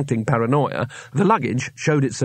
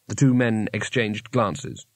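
ordinary delivery, nothing marked

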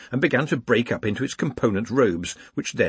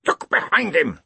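ordinary delivery

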